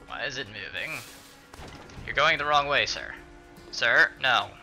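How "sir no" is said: ordinary talk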